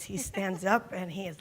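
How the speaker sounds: ordinary talk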